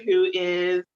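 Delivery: ordinary delivery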